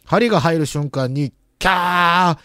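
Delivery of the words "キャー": ordinary talk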